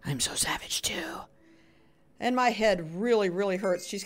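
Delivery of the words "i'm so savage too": low unvoiced whisper aside. Real, not acted. Gamer whispering a boast to her viewers.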